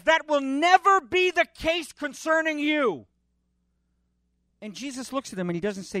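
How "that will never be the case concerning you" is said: high, slow, firm refusal as Peter; insistent Peter. Acted. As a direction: Peter protesting his master's fate, pitched high and slow. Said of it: "The Greek says".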